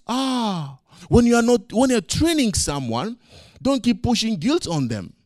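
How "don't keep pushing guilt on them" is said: ordinary delivery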